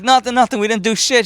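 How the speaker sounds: mock innocent protest; innocent denial. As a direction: act innocent, a bit too eager.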